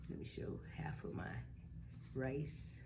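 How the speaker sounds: soft whispered aside; whispering. Real, not acted. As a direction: whisper it to the camera. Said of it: "whispered aside".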